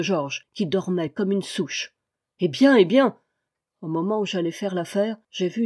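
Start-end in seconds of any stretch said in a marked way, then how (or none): 2.4-3.2 impatient urging. A narrator's reading. Impatient master pressing steward.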